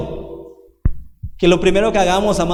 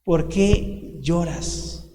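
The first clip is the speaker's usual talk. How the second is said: softer and slower, hushed and gentle; tender, hushed echo; 3 semitones lower, 6 dB quieter, much slower than usual. Acted. Jesus gently asks the weeping disciple, hushed and tender. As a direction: let the question fall away softly, full of pity. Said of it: "Jesús le pregunta en dos ocasiones".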